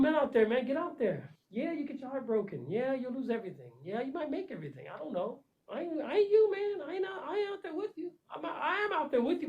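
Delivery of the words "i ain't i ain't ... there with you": getting emotional. Real, not acted. To the men out there, voice breaking with emotion. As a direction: voice cracking up high with feeling. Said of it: "I'm getting emotional".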